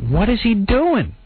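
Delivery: lightly acted disbelief; baffled. Telling the host about the photographer, slow puzzled question.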